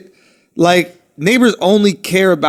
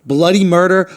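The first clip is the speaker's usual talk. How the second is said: ordinary talk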